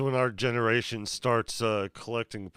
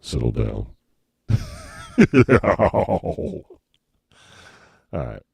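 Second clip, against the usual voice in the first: low processed voice; deep voice changer; 7 dB louder than usual. Pretend. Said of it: "the deep voice".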